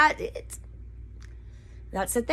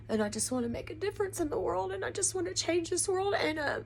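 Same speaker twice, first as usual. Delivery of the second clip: softer, higher voice breaking into tears; real crying; 6 semitones higher, 9 dB quieter than usual. Real, not acted. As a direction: push through the words while starting to cry. Crying on camera.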